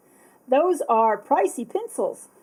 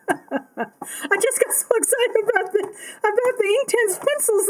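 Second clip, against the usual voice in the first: higher, laughing through the words; 9 semitones higher than usual. Real, not acted. Apologising to viewers for forgetting, laughing. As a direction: giggle it out, embarrassed.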